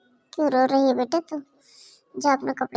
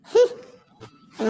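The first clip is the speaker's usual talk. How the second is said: normal talking voice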